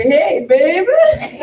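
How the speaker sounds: put-on playful New Orleans accent greeting. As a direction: ham up the local New Orleans "Hey, baby.". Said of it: "or as they say down here".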